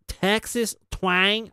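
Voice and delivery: slow, half-successful Texas drawl; attempted Texas twang. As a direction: stretch it into a badly faked Texas accent. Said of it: "I can't even do it".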